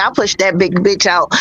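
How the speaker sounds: ordinary delivery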